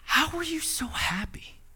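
hushed, breathy whisper of a puzzled friend; whispered question. Retold or imitated. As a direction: whisper it like a secret. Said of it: "he looks at me, and he goes".

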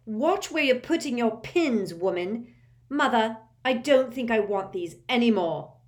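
loud, high bratty snapping; spoiled angry shout. A narrator's reading. Malfoy snapping at Madam Malkin and his mother, loud and bratty. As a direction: throw a tantrum at the woman.

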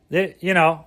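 ordinary talk

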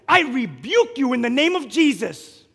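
slow, loud rebuke; slow commanding rebuke. Retold or imitated. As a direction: cast it out with authority. Casting out the reptile.

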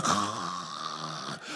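long, low snore; comic drawn-out snoring noise; imitated snore. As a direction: snore as if suddenly fast asleep. Imitates falling asleep reading Bible.

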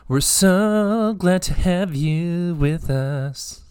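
high, slow sing-song welcome; drawn-out greeting. Acted. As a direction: stretch every word sweetly. Co-host joins the welcome.

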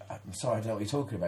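fast, flustered apology; retold hurried apology; rushed apology. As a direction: gabble it out nervously. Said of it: "I said".

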